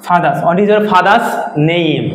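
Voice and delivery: slow demo, "father's" said fa-das. Shown as a demonstration. Teacher answers the pronunciation question, "father's" without R. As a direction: slowly drop the R in "ফাদার্স" and "father's". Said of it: "উচ্চারণটা হবে কি?".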